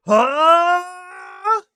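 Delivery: high, loud startled huh; mock shocked bystander; stunned huh. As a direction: react like your eyes are bulging. Onlookers stunned by battleship.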